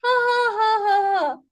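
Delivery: high sustained rhythmic laugh; deliberate ha-ha, stepping down; green light laughter. Shown as a demonstration. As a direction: laugh on cue in one long run. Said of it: "you laugh louder".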